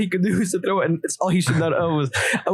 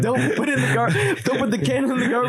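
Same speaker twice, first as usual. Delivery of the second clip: mom's warning repeated through laughter, higher; laughing quote of mom; 6 semitones higher than usual. Retold or imitated. Telling friends about the burning trash, laughing while quoting mom. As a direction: quote her through giggles. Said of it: "She literally said".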